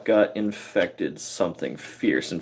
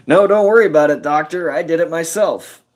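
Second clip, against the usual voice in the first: louder, higher breezy reassurance; cheery reassurance; 6 semitones higher, 4 dB louder, faster than usual. Retold or imitated. Patient cheerfully telling the doctor he did it himself, louder. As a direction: brush it off brightly. Self reassuring nurse, retold.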